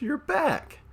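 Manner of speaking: high, delighted squeal of greeting; excited cat witch. Acted. Cat witch greets returning captor.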